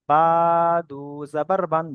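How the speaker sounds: বা held for one long beat, then দু জাবার বান; one-alif stretch. Shown as a demonstration. Teacher demonstrating tanween spelling of বা, measured stretched recitation. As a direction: draw out the vowel of "বা" moderately. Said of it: "বা এক আলিফ পরিমাণ টান".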